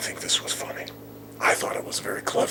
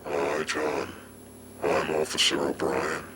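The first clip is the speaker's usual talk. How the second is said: low, measured policeman voice; imitating a cop's low, deliberate greeting; slow and low; 4 semitones lower than usual. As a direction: speak low and slowly, like an officer introducing himself. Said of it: "said to me real slow and low".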